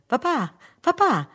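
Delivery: high, loud, drawn-out cry of "papà"; excited child's call. Acted. Children running to greet their father, excited calling. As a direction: call out to daddy like an eager little kid.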